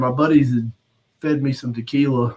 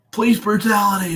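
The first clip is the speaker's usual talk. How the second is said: imitated crowd yell; 8 semitones higher than usual. Crowd yelling at the cops.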